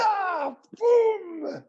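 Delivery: high, shouted comic boom; a playful yelled boom as a punchline; mock boom. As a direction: punch it out like a drum sting. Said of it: "we need [name] doing some sound effects".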